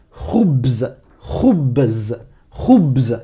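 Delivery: "khoubz" with rasped kh and buzzing bz; Arabic pronunciation. Shown as a demonstration. Teacher models the word for bread, "khoubz" with guttural kh. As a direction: draw out "khoubz" from throat scrape to final z. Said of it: "en arabe se dit".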